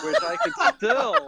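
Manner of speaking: breaking into laughter mid-sentence; laughing. Real, not acted. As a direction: laugh through the words. Judge cracks up mid-ruling.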